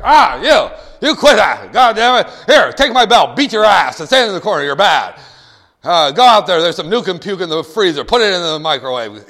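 loud, hammed-up scolding; mock angry parent. Lazy parent in the chair to the kid, acted angry shouting. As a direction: yell at the kid in mock fury.

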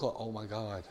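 low, soft mock alarm; hushed dread. Speeder spotting the sheriff's car, acted hushed dismay. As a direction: mutter it as if just caught.

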